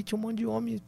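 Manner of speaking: ordinary talk, nothing marked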